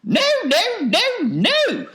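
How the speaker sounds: loud, very high falsetto protest; Wonka's alarmed cry. A narrator's reading. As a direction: cry out in piping panic. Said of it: "cried Mister Wonka".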